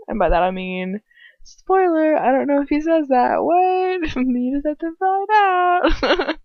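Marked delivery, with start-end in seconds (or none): 1.4-6.4 high sing-song coyness; playful teasing. Real, not acted. Keeping a spoiler from listeners, playfully teasing. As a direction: tease it high, stretching the end.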